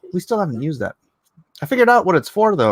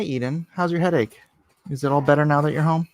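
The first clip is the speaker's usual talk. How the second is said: usual speaking voice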